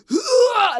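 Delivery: sharp, very high, loud gasping roar. Retold or imitated. Mother in labor gasping as she gives the final push. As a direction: suck in air and roar it out. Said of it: "I roared".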